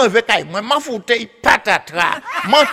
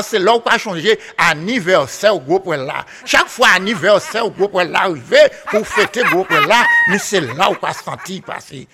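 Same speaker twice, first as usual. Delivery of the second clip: usual speaking voice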